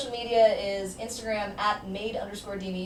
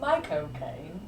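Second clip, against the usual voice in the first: Michael Caine impression; 5 semitones lower, 4 dB quieter, much slower than usual. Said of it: "I can never do it right".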